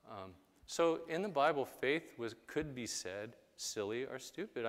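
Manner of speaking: normal talking voice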